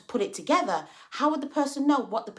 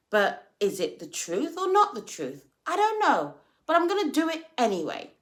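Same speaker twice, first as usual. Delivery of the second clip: ordinary talk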